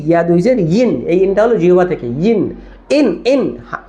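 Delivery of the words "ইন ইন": "ইন" repeated to show where it is articulated; tongue versus throat "ইন". Shown as a demonstration. Teacher demonstrates where "ইন" is articulated, tongue not throat. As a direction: show the starting sound of "ইন". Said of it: "গলা থেকে না".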